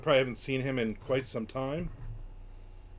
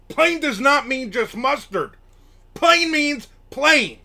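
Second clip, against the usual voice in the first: loud, high, slow indignant shout; pissed-off yelling; 12 semitones higher, 12 dB louder, slower than usual. Retold or imitated. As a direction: yell it word by word, re-enacting the outrage. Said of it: "I was so pissed".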